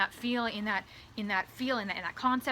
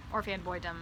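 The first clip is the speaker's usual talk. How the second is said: ordinary delivery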